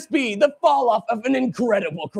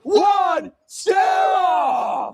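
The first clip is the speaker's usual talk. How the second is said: countdown shout; 10 semitones higher, much slower than usual. Acted. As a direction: count it down and yell the start. Countdown to the contest.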